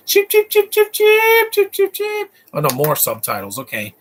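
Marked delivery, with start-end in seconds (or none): 0.1-2.3 high squeaky chicken chirps; silly high chicken clucks; chicken clucking. Pretend.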